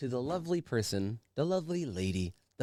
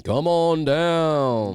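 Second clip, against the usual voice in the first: game show host; 3 semitones higher, 8 dB louder, much slower than usual. Acted. Game-show call to guest.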